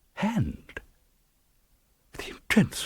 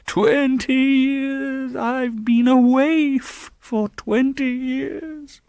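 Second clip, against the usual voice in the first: high, very slow, louder moan; moaning ghost; 4 dB louder, much slower than usual. A narrator's reading. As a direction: moan the words out like a lost spirit. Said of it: "moaned the voice".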